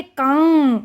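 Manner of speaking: "कांग" with a long nasal आ; "कांग" drawn out and ringing nasally; nasalised vowel demo. Shown as a demonstration. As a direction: hold "कांग" through mouth and nose. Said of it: "इसका उच्चारण हम करेंगे".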